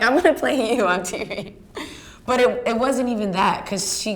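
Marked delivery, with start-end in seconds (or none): 0.0-1.6 excited promise. Retold or imitated. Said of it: "I'm like".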